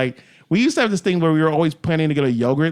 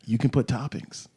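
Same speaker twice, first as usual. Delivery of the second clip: softer voice, as if leaned in close; imitated confiding tip; 4 semitones lower, 8 dB quieter than usual. Yogurt shop dude tipping off a customer, imitated hushed confiding. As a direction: share it like an illicit secret. Quoted dude after leaning in, soft.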